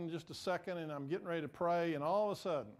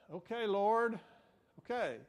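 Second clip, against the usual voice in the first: higher, louder, reluctant "all right already"; mock exasperated giving-in; 5 semitones higher, 5 dB louder than usual. Preacher finally giving in to God, exasperated. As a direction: give in to a nagging voice. Gives in to God's nagging.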